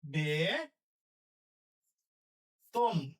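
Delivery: very slow drawn-out word; drawn-out dictation. Shown as a demonstration. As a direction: stretch it for writing. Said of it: "dictating word".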